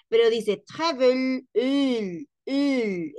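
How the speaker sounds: travel slow, final ul drawn out; ending sound of travel. Shown as a demonstration. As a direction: stress the ul at the end of travel. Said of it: "¿Escuchan al final?".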